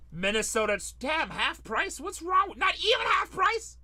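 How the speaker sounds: shouting high and loud in disbelief; a real shocked shout at a bargain; outraged disbelief. Real, not acted. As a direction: yelp it out like you can't believe the price. Shocked at the half-price sale.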